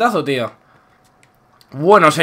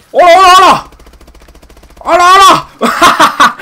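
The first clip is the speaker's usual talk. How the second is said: mock greeting; 9 semitones higher, 6 dB louder than usual. Real, not acted. Greeting enemy mockingly.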